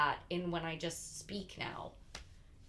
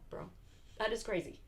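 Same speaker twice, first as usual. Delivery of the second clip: ordinary talk, nothing marked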